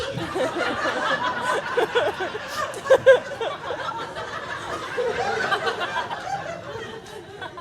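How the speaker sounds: long high laugh; high giggling. Real, not acted. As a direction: giggle at your own joke. Long laugh at own joke.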